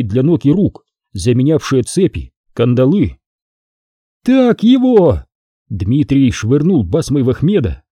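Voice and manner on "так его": high, loud, slow cry of approval; cheering shout. Acted. Onlooker cheering as the envoy is put down, excited shout. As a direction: cheer him on.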